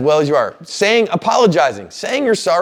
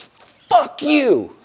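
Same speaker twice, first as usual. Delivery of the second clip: imitated angry insult; 11 semitones higher than usual. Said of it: "looks me right in the eyes and goes".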